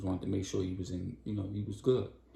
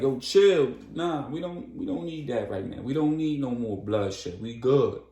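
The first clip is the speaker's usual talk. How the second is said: urgent calming; 4 semitones higher, 6 dB louder than usual. Retold or imitated. Star breaks up the fight.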